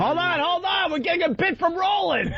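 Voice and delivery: very high mocking falsetto; high squeaky voice. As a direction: squeak it out like a cartoon. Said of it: "your new nickname is Tender Voice".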